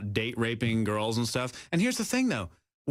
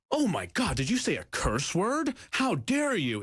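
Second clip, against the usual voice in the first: mock shock; 8 semitones higher than usual. Mocking hypocrites' shock.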